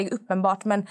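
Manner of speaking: ordinary delivery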